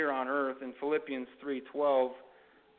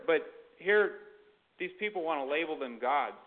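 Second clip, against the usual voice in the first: ordinary delivery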